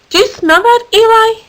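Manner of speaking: high excited question to his pal; curious sniff. Acted. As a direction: squeak it out, nose up. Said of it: "he asked his elephant pal".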